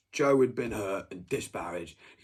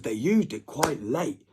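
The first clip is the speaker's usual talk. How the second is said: ordinary talk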